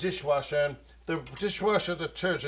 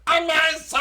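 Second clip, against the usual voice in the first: sudden exclamation; 11 semitones higher, 9 dB louder than usual. Real, not acted. Sudden exclamation while cooking.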